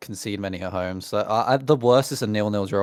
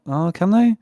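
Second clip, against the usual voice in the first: surprised; 9 semitones higher, 9 dB louder than usual. Real, not acted. Sudden doubt mid-sentence.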